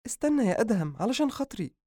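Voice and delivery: high, imploring sister's voice; begging sister. Acted. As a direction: plead with him to stop. Leila begs brother.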